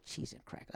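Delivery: weary sigh then soft groan; exasperated sigh. Real, not acted. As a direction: breathe out, fed up. Sigh at cancelled show.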